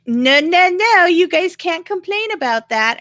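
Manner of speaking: brushing off the complaint in his voice; mock dismissive quote. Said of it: "he was all like".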